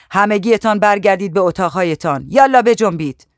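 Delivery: stern housekeeper yelling yalla; shouting at everyone. Acted. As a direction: drive them all back to their rooms. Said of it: "خطاب به همهٔ ما داد زد".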